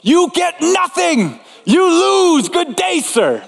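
high, slow shouted mock Wonka rant; Willy Wonka. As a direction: bellow it like a gleeful, furious candy maker. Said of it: "if you wanna hear Willy Wonka's voice".

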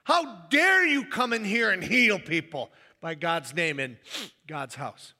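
acted-out indignant outburst, loud at first then dropping; livid leader. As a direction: scold them in mock righteous indignation. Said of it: "This guy is livid".

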